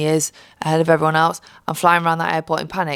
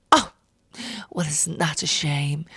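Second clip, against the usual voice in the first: smug fake sympathy; 3 semitones lower, 5 dB quieter than usual. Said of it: "I said".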